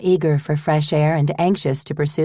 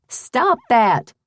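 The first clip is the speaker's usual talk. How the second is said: higher, sharp call at the dog; 8 semitones higher than usual. Acted. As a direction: stop the dog with one command. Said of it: "Margaret called to Honey".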